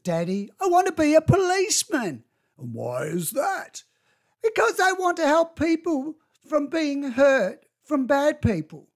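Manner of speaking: a hint of an eager young child. Child's words quoted by parent.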